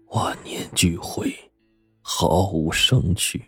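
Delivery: soft, sorrowful, breaking into near-whisper. A narrator's reading. Butler urges grieving lady to drink, subdued and sorrowful. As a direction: console her quietly and heavily. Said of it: "管家低着头说".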